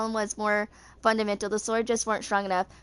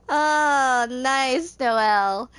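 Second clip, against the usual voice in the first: amused reaction; 3 semitones higher, 6 dB louder, much slower than usual. Real, not acted. Amused reaction after laughing at story.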